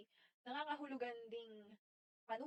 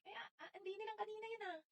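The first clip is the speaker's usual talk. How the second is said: quick, high, softer Batangas-Bulacan lilt; mother's Batangas-Bulacan accent; 8 semitones higher, 6 dB quieter, faster than usual. Retold or imitated. Quoting mother, quick high Batangas-Bulacan accent. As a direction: imitate mom's Batangas-Bulacan punto, fast and high. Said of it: "punto ng Batangas and Bulacan sa nanay ko".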